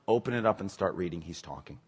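ordinary delivery

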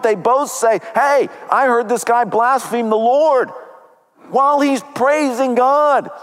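fervent preaching. Acted. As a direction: lift the congregation as the tale climbs. Preaching the story at full force.

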